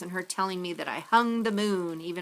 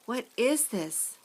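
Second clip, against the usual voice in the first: slow, higher, hushed wonder; awed question; 4 semitones higher, slower than usual. A narrator's reading. Olive to the family, slow awed wonder. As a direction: ask in amazement.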